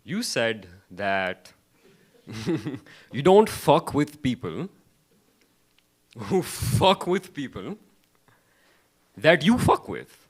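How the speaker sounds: loud, slow, high mock drive-thru guy; a hammed-up Tim Hortons worker, slow and loud; mock cashier. Comic imitating the cashier who got it wrong, loud and slow. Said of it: "he's like".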